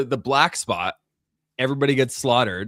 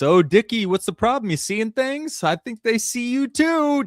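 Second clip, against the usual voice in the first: high, sing-song taunting clown voice; taunting Pennywise; 12 semitones higher, 4 dB louder than usual. Retold or imitated. Pennywise mocking Dick amid the flames, high taunting voice. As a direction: tease him from up high like a gleeful killer clown. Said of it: "Just taunting everybody".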